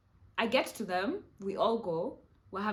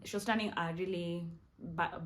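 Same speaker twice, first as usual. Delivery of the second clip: ordinary talk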